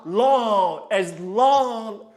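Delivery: loud, drawn-out "long", held like the leg; sustained, loud coaching call on "long"; stretched-out cue. Shown as a demonstration. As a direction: stretch each "long" to make the dancer hold.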